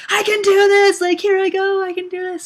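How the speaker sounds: very high, put-on excited squeal; burst of energy. Runner psyching herself up mid-race, hammed-up high excitement. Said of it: "how you would get these, like, bursts of energy".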